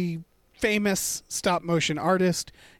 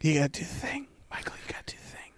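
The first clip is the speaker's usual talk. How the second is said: stage whisper; 4 semitones lower, 9 dB quieter, much faster than usual. Acted. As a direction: whisper it so everyone hears. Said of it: "they can't hear you as long as you speak in that stage whisper".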